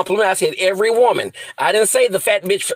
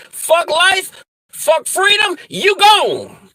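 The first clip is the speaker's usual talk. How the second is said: furious yell; 9 semitones higher, much slower than usual. Pretend. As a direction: scream it like you've snapped completely. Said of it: "I'ma say".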